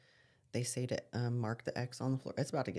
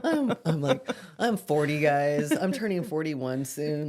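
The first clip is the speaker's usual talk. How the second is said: louder, playful indignant protest; half-laughing protest, much louder, about being forty; 8 dB louder than usual. Real, not acted. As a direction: throw up your hands and protest it.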